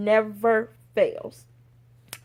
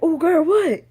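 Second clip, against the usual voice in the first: loud, raised-pitch alarmed questioning; alarmed reaction; 6 semitones higher, 7 dB louder than usual. Retold or imitated. Boss told don't be mad, alarmed. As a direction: blurt it out higher and louder.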